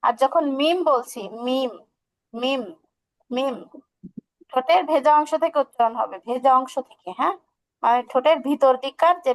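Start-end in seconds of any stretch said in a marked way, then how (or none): none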